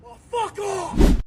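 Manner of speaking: high, slow furious shout; angry shout. Retold or imitated. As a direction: scream it back at the car. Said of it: "driver retorts to yell".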